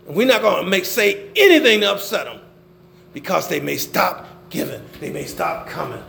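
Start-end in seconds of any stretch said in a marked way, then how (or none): none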